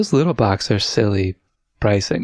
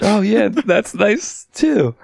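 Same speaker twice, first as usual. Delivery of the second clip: high, slow, overly cheery reply; fake enthusiasm; 10 semitones higher, much slower than usual. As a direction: stretch it out with forced brightness. Retelling his own awkward reply.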